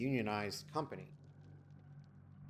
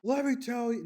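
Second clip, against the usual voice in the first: higher, louder mock caller outburst; imitating angry caller; 12 semitones higher, 5 dB louder than usual. Caller starting to rant at the agent, higher louder mock outburst. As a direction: play the caller launching into a rant. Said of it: "They're like".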